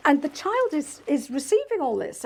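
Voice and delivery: usual speaking voice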